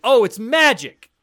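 loud, high, drawn-out mock wonder; mock explanation. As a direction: stretch it out big and high, sarcastically. Mock answer to own logistics question.

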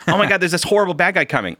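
fast, high fake panic; hammed-up gasp of alarm; mock alarm. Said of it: "It's like".

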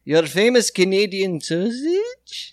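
high, German-tinged mock Canadian accent; hammed-up German accent with a Canadian "eh"; German faking Canadian. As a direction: play a German badly passing as Canadian. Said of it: "like a Nazi trying to pass as a Canadian".

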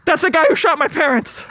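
put-on a kid blurting out his accusation; young Bruce Wayne pointing out the killer; laughing child witness. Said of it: "baby Bruce Wayne".

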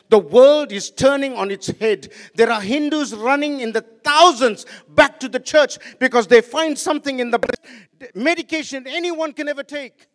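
loud sermon push, first line slow; preaching at full force. Acted. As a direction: drive the point home to the church. Preaching to the congregation.

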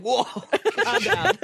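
high, slow laughing mock alarm; Tyler calming. Tyler, shocked at the pole swing, high and laughing. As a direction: squeak it out through laughter. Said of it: "Tyler was like".

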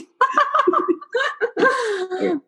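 high laugh breaking up; snorting laugh. Real, not acted. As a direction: laugh helplessly. Guest laughs hard.

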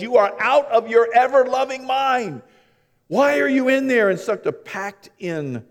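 mock scolding mother. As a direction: scold him like an exasperated mom.

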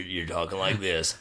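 lightly put-on voice, a little low; imitating co-host's impression. Host answering co-host's mimicry, lightly mocking voice. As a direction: parody the voice he is mocking you with. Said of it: "if this is your impression of me".

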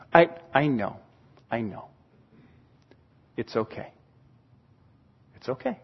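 very soft, low and unhurried; reassuring parent. Acted. Parent calming a guilty child, reassuring. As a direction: hush the child tenderly, leaving long pauses.

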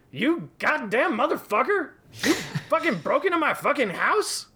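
very high furious scream. A narrator's reading. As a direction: explode at him with rage. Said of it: "he screamed".

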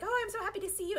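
high, gushing Luna voice; high cutesy cat voice. Retold or imitated. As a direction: gush it up high like a cute cat.

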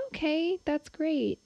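high, cheery "okay, that's great"; high encouraging mom voice. Retold or imitated. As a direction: humor the kid brightly. Mother's reply in story.

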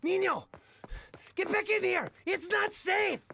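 father calling son from danger; urgent call. Acted. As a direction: call him back urgently. Father calls son back.

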